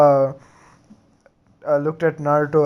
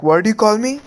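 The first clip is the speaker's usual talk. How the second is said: indignant outburst; 6 semitones higher than usual. Acted. Sakura to Naruto after his insult, indignant. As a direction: snap back at the insult, outraged.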